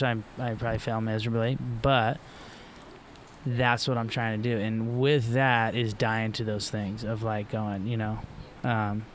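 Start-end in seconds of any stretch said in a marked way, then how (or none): none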